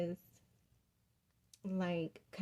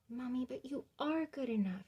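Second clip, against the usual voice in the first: a hint of a little girl, higher, with conviction; lightly voiced daughter; 5 semitones higher than usual. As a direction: lightly mimic the daughter's earnest reassurance. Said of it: "she said it with such conviction".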